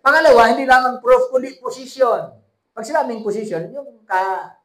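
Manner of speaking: loud, high preaching with slowed scripture; fiery preaching. Acted. Pastor preaching loudly to his congregation. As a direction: proclaim it to the whole church.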